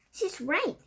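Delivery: high excited cry; cried. A narrator's reading. Another witch agreeing, high excited cry. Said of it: "cried another voice".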